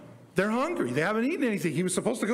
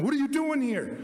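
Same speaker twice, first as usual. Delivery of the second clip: alarmed priest; 7 semitones higher than usual. Acted. The priest freaks out at David.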